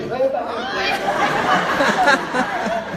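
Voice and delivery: big loud high laugh bursting out; hearty laugh. Real, not acted. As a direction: laugh out loud, fully amused. Audience laughing at the scene.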